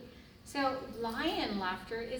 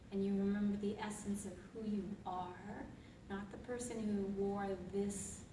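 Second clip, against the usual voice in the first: guided meditation; 3 semitones lower, 4 dB quieter than usual. Acted. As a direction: lead them gently into the present. Guided mindful reflection.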